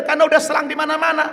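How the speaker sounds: high, fast doctor explanation; doctor rattles off the reason, high and quick; hurried doctor. Retold or imitated. Doctor explaining to son, fast and high.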